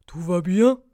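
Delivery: loud badger question, rising at the end; badger checking in. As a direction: ask loudly as a storybook badger. Blaireau s'inquiète. (question sonore de blaireau)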